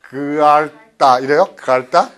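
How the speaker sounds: drawn-out, loud "그알다"; both consonants sounded. Shown as a demonstration. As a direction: stretch "그알다" slowly, trying to voice every consonant. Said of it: "리을 기억을 다요".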